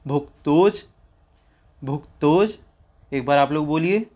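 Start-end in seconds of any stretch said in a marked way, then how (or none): 0.1-2.6 भुक्तोज said twice, clearly, for echoing; model for repetition. Shown as a demonstration. Teacher drilling भुक्तोज for students to repeat. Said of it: "तो उच्चारण कीजिए".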